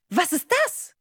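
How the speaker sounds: high, startled question; nervous. Acted. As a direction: ask it jumpily, pitched right up. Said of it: "fragt Leo nervös". (nervös, erschrocken gefragt)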